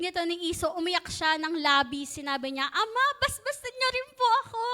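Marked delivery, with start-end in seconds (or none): 2.7-4.6 begging son. Retold or imitated. Weeping Esau begs father.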